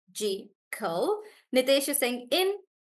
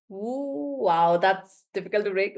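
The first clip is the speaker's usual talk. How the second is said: ordinary delivery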